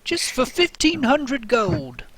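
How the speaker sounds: high, slow sales cry; market hawker. Acted. Goggle seller calling to passers-by, high drawn-out hawker's pitch. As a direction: sing out the price like a vendor. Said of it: "somebody go".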